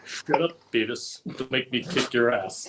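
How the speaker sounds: Butt-head voice. Acted. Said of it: "I got one".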